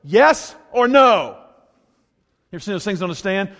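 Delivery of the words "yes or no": higher, louder, slow demanding yes or no; cross-examine God like a lawyer; lawyer prayer. Retold or imitated. Preacher voicing a demanding lawyer prayer to God, slow and pointed. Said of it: "give him the lawyer prayer".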